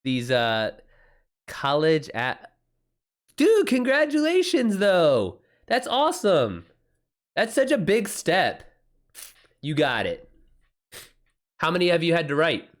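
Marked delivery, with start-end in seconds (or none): 3.4-8.6 high, bright burst of real excitement; excited congratulations. Real, not acted. Streamer congratulating a viewer on college, excited and high. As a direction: cheer them on, thrilled for them.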